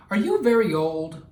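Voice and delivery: put-on a curious boy asking his grandfather his age; child's voice. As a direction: ask like a curious little boy.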